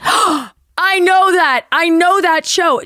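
light up with relief; imitating a thrilled hiker; relieved recognition.

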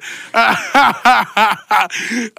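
long, loud belly laugh; helpless run of ha-has; loud laughing fit. Real, not acted. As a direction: burst out laughing mid-story. Cracking up mid-story.

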